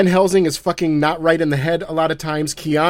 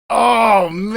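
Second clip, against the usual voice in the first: 1 awed reaction; 6 semitones higher, 3 dB louder than usual. Retold or imitated. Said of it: "And I was like".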